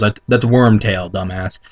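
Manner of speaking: usual speaking voice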